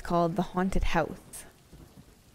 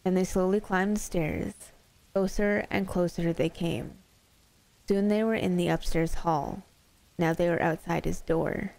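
usual speaking voice

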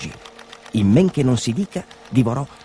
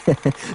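short acted snicker; sly chuckle; 4 semitones higher than usual. A narrator's reading. Dog secretly laughs at the butcher, snickering. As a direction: chuckle to yourself like a sneaky dog. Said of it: "Orazio ridacchiava silenziosamente fra sé".

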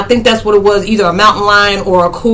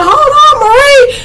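imitating a high-pitched holler; panicked mock call; 5 dB louder than usual. Retelling a man calling to a woman in the wind, high mock holler. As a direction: call out high and loud, hammed-up.

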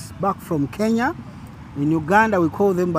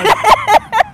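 much higher, louder playful cabin call; flight attendant voice; 12 dB louder than usual. Pretend. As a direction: sing out the greeting like crew. Echoes flight greeting.

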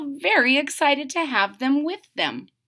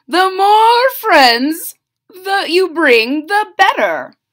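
hearty royal letter; 7 semitones higher, 8 dB louder, slower than usual. Acted. King and queen letter.

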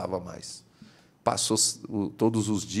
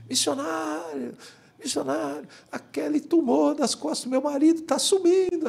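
woman's cry. Retold or imitated. Woman in the crowd calling to the missionary, high cry. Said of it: "uma mulher deu um grito".

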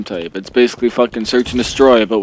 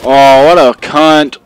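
angry; 4 semitones higher, 10 dB louder, much slower than usual. Real, not acted. Angry outburst after losing round.

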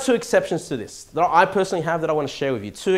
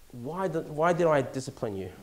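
gentle parent; 7 dB quieter than usual. Retold or imitated. Parent gently questioning son after discipline.

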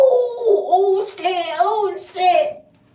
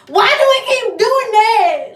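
frustrated; 5 semitones higher, 10 dB louder than usual. Real, not acted. Fighting her hair in frustration.

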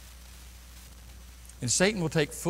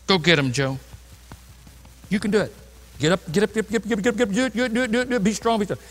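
mock pep talk; much faster than usual. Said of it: "self pep talk mock coach".

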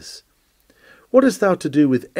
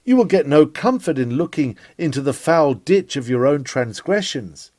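ordinary delivery

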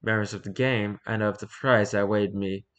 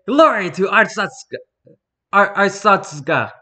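shouted, higher salute call; loud salute; 8 semitones higher, 8 dB louder than usual. Acted. Soldier saluting an officer, loud proud call.

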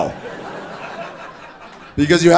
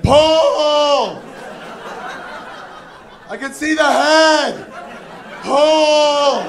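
much higher mock midwife yell; yelling doctor; 11 semitones higher, much slower than usual. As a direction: urge Paul on like a birthing coach. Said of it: "just yelling".

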